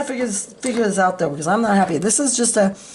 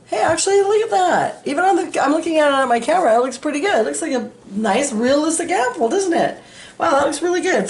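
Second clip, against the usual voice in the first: excited; 8 semitones higher than usual. Real, not acted. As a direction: gush over how good it looks. Delighted by her finished apple.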